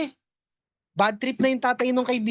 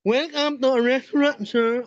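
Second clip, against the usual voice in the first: ngongo voice. Acted. Waiter speaking ngongo.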